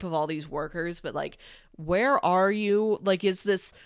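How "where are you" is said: ordinary talk, nothing marked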